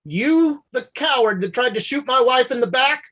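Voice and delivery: rising fury at the coward; high, loud angry accusation. Acted. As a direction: spit out the accusation. Confronting wife's attacker.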